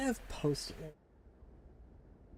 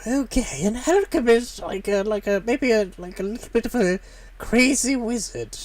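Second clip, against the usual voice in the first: crazy wizard voice; 8 semitones higher than usual. Acted. Trying out an alchemist voice.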